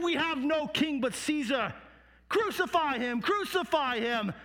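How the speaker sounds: high, defiant mob answer; crowd crying out. Acted. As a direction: shout it back as an angry crowd. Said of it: "cry out even louder".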